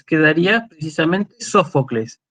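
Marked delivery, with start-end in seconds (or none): 1.4-2.2 Sófocles, stressed on the opening SÓ; Spanish stress on first syllable. Shown as a demonstration. Lecturer showing the Latin-rule accent, stressed SÓ in Sófocles. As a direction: say Sófocles as in Castilian, accent up front. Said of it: "yo lo acentué Sófocles como en castellano".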